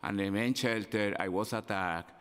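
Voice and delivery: usual speaking voice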